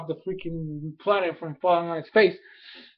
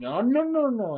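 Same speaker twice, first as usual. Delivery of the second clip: mock alarmed refusal; 9 semitones higher than usual. Reacts to hypnotized pair's suggestion.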